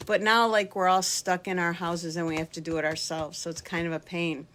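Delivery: ordinary delivery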